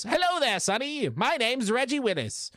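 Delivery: a cheery high shopkeeper character welcoming the viewers; Reggie Willis voice. Pretend. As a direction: greet the listener like a chatty old shopkeeper. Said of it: "you want my Reggie voice?".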